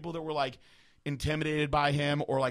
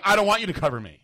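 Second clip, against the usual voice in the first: raised, loud retold objection; loud protest; 6 semitones higher, 12 dB louder than usual. Diner refusing to let the host pay, protesting. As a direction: push back loudly, put on the spot. Acted protest at being singled out.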